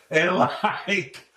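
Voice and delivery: laughing. Real, not acted. Laughing while telling story.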